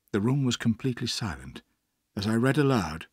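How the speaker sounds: ordinary delivery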